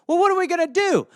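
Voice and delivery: high, loud put-on disbelief; mock shocked official. Official hears Saddam is still alive, high mock disbelief. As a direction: act stunned by the news. Acted dialogue, panicky voice.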